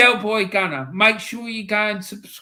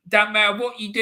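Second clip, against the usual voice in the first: ordinary talk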